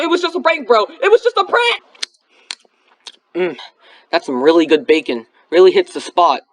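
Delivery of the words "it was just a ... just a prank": high, panicked pleading. Acted. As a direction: beg in panic as the knife comes. Threatened with a knife.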